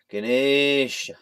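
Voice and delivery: "Ganesha" slow and loud, as guh-NAY-shuh; drawn-out Ganesha. Shown as a demonstration. Showing the extra effort, "Ganesha" stretched as guh-NAY-shuh. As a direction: hold a long AY in "Ganesha". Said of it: "adding extra syllables for me to say".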